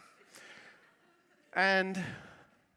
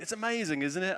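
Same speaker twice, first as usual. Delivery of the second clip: retold shout; 8 semitones higher, 5 dB louder than usual. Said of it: "he sort of shouted in my ear and went".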